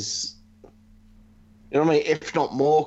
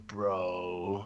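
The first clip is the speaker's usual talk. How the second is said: low, soft drawn-out "Bro"; Matt Riddle; 9 semitones lower, 10 dB quieter than usual. Retold or imitated. Host imitating the wrestler's catchphrase, low drawn-out "Bro". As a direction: stretch out "Bro" low and quiet. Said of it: "as Matt Riddle goes".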